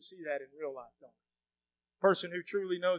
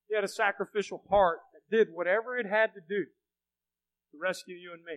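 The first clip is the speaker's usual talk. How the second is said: ordinary talk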